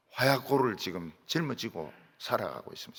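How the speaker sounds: usual speaking voice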